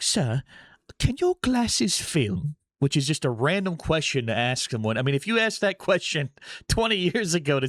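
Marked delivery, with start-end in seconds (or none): 0.0-2.6 imitating British usher. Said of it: "She's like".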